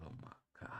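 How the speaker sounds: breathless whispered awe; hushed oh my god at the chart; awed whisper. Real, not acted. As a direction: whisper it in amazement.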